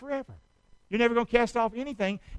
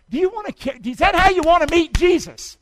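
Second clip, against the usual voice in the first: 1 loud challenge; 8 semitones higher, 8 dB louder than usual. Real, not acted. Preacher challenges congregation.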